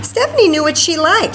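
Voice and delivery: ordinary delivery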